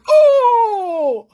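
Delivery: very high, loud drawn-out 'oh'; mock boy's whoop. Boy laughing at his own joke, mocking whoop. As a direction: whoop at your own crude joke, imitated.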